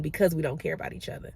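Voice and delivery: ordinary talk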